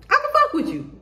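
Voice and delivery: high, laughing playful voice; weird friend voice. Acted. Weird genuine friend warming to you, playful high voice. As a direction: squeak it out, amused and approving.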